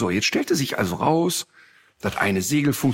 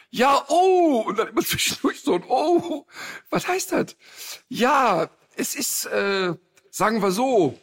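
much higher, frightened moaning voice; panicked friend; 9 semitones higher than usual. Retold or imitated. Friend on a stormy boat admits the ship is unseaworthy, high and frightened. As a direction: let out a scared moan between words.

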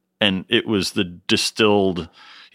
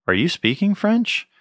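baffled question; 11 semitones higher than usual. Retold or imitated. Arriving in the Ardèche and not understanding the locals, baffled. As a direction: pitch jumps way up, rising in disbelief.

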